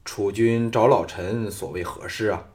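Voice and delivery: ordinary delivery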